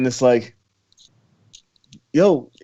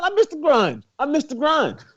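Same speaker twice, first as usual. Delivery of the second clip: "grind" sung out much higher and playful, like a show slogan; playful excited callback; 11 semitones higher than usual. Pretend. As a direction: call out "I missed the grind" way up high like a catchphrase.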